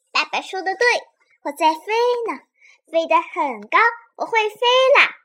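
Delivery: high, joyful cry of triumph; excited. Acted. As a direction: shout with delight at flying. Pterosaur flies for the first time.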